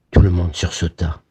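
plain reading voice

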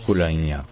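Bologna as "bu-LA-nya", stress on LA; Bologna, darker first vowel, open stressed a; Emilian pronunciation. Shown as a demonstration. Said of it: "en émilien, c'est plutôt".

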